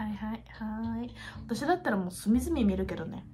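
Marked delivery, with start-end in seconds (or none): 0.1-1.1 breathy aside; whispering. Real, not acted. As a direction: whisper it to yourself.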